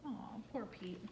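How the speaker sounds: ordinary delivery, nothing marked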